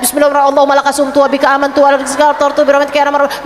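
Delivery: mock rushed prayer said in one breath; rushed gabbled prayer. Shown as a demonstration. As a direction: rattle the prayer off very fast. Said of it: "bukan di saat denger adzan".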